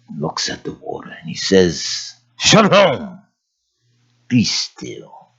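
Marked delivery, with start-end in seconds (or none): 2.4-5.3 firm command sinking to a hush; calm authority. Acted. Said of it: "he said it with authority".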